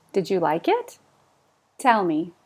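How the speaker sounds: usual speaking voice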